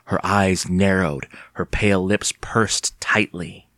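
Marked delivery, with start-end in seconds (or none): none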